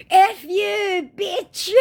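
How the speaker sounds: high, mocking put-on voice for the scammer; whiny high imitation of a chat scammer.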